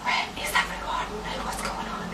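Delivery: hushed, breathless whisper of confusion; lost in an empty mosque, whispering; confused whisper. Retold or imitated. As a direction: whisper it like you're afraid to break the silence.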